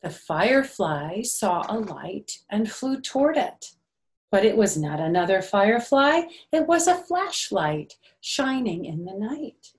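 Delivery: gentle, sing-song storybook reading; lightly lilting picture-book narration; reading to children. A narrator's reading. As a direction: read it like circle time for little ones.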